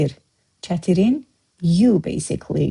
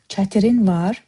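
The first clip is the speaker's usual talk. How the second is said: Çətirin var with çətirin stressed and rising; Çətirin var asked with a rise on çətirin; question by stress. Shown as a demonstration. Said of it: "We just emphasize the word we wanna ask".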